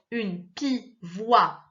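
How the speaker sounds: "pivoine" very slowly as pi, voi, ne; syllable by syllable. Shown as a demonstration. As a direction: cut "pivoine" apart before the final ne. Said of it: "c'est coupé en deux ici".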